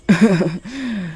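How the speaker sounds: witch's laugh. Acted.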